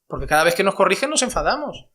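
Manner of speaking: ordinary talk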